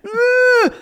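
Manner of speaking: high, loud muffled eh through a Lego; Frankenstein groan. Retold or imitated. Her son with a Lego stuck in his mouth, loud high groan. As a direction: mimic a kid groaning with his mouth stuffed. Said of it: "He's like Frankenstein".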